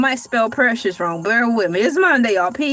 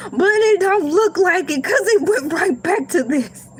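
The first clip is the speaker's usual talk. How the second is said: laughing through the words; 7 semitones higher than usual. Retold or imitated. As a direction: laugh as you say it. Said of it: "I was like".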